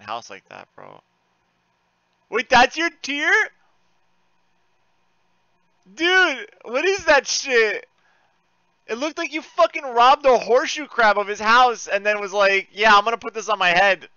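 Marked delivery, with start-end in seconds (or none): 2.3-14.1 high, loud, disbelieving shout; appalled exclamation, pitched way up; incredulous outburst. Real, not acted. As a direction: roast them in loud disbelief. Incredulous at someone's game gear.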